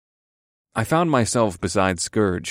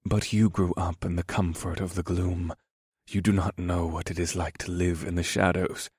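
hushed, grave character voice; Scourge the horned thief; 3 semitones lower, 4 dB quieter than usual. Acted. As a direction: warn them softly and gravely. Narrator voices Scourge's grave warning.

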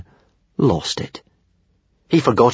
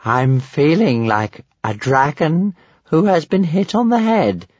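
slow, higher dragon voice, woozy; dazed dragon; 8 semitones higher than usual. Acted. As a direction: drawl it slowly as a knocked-on-the-head dragon.

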